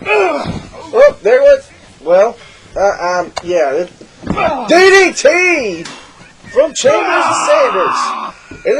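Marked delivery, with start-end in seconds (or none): 4.7-8.6 drawn-out, high hype shout; excited call. Real, not acted. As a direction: stretch it out like a ring announcer. Big move called in commentary.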